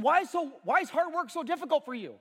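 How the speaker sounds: mock scolding. Scolding son in church.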